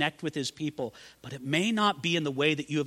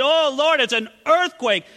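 higher, louder awed exclamation; excited prophet; 10 semitones higher, 7 dB louder than usual. Acted. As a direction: Elijah crying out at the quake. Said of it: "see Elijah there".

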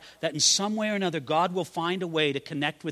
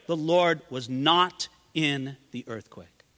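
slow refrain; 3 semitones lower, slower than usual. Acted. Sermon refrain, slowed.

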